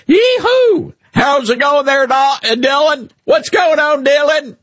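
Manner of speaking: high-pitched mock Southern whoop and greeting; very high, hammed-up Southern holler; exaggerated Southern accent. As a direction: tease with an overdone Southern twang. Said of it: "I have a Southern accent, but you're like".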